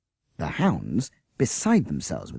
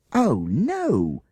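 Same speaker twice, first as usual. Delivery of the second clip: cry of horror; 8 semitones higher, 4 dB louder than usual. Acted. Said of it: "cried the children in horror".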